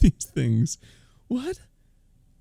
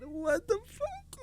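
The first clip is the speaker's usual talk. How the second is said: very high, slow, strained disbelief; real stunned cry; shocked squeal; 10 dB quieter, slower than usual. Real, not acted. Stunned reaction to clip.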